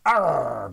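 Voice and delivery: loud rolling growl; dinosaur growl. A narrator's reading. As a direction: growl back in refusal. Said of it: "growled the super hungry dinosaur".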